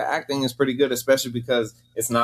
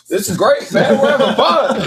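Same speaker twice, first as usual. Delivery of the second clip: laughing excitedly; 7 dB louder than usual. Retold or imitated. Excited quote with Ramon on set.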